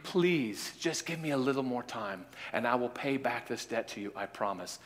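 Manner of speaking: poor debtor pleading quietly with the freed man; soft pleading. Acted. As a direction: beg softly, voice dropping away. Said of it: "the guy says, "Please".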